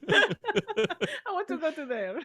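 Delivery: high, slow, wailing mock village woman; sad village woman. Joining the joke, wailing like a sad village woman. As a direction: plead to go, drawn out and high. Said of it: "sad Italian village woman".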